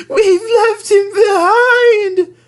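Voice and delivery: very high, slow crying wail; sobbing. Acted. Coaches sob about the guard, very high crying. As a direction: wail it through tears. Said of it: "sobbed Annie and Clarabelle".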